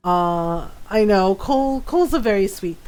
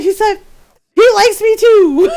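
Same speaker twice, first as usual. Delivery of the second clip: very high and much louder squealing; a friend squealing that her crush likes her back; giddy squeal; 10 semitones higher, 10 dB louder than usual. Retold or imitated. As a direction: shriek the good news with glee.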